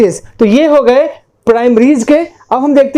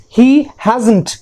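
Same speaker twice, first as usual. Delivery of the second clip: ordinary delivery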